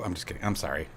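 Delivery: ordinary talk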